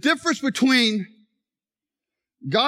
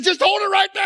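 urgent order; 10 semitones higher, 3 dB louder than usual. Retold or imitated. Injured player giving an urgent order.

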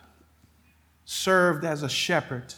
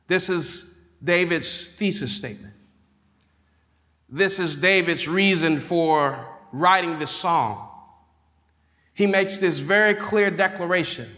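preaching; 5 semitones higher than usual. Acted. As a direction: build the sermon up with conviction. Preaching builds on the thesis.

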